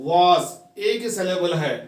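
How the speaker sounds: ordinary talk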